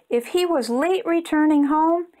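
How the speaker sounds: usual speaking voice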